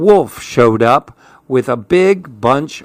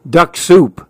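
higher wolf voice, falling statement; storybook wolf; 4 semitones higher, 4 dB louder than usual. A narrator's reading. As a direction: announce it as the scheming wolf. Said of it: "said the wolf".

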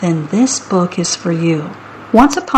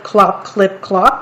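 ordinary delivery

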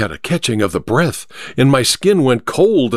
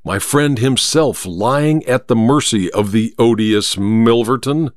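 plain reading voice

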